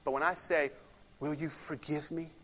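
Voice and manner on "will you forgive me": much softer, humbled asking; humble soft request. Shown as a demonstration. Preacher contrasting an easy sorry with asking forgiveness, humble. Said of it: "that's complete humility".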